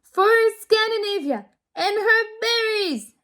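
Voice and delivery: high, loud rallying shout; battle cry. A narrator's reading. Lily shouts before jumping off the rainbow bridge, loud and high. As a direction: shout it as she leaps. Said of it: "and shouted".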